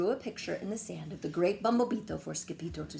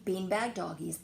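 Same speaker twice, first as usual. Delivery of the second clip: ordinary delivery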